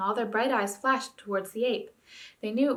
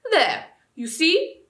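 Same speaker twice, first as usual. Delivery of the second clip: gloating ape; 8 semitones higher, 7 dB louder, much slower than usual. A narrator's reading.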